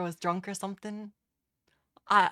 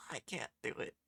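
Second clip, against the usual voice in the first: scared whisper; 6 semitones lower, 6 dB quieter, faster than usual. Real, not acted. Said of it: "I'm too scared".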